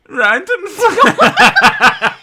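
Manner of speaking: put-on very high, loud German accent, breaking into laughter; hammed-up German shout that collapses laughing; exaggerated German, loud and high; mock German accent. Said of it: "actually read it in a German accent".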